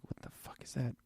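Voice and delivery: whispered mock disbelief; baffled aside. Retelling his first look at the trailer outfits, baffled whisper. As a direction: whisper it as a retold private reaction.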